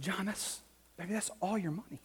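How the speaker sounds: a father quietly amazed at his son; tender. Retold or imitated. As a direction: hush it like a touched father. Dad moved by son's gift.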